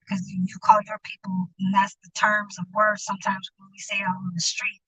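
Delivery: hushed unvoiced whisper; whispering it close to the mic. Real, not acted. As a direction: drop to a whisper, confiding. Confiding aside.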